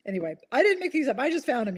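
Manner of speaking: usual speaking voice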